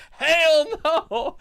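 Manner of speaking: very high, loud imitated whoop; mock outraged denial. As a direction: holler it as the scandalised crowd. Acting out the audience's reaction.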